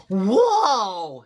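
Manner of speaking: high, loud whoa; amazed exclamation. A narrator's reading. Skipito steps into his jungle closet, amazed. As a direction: burst out in amazement.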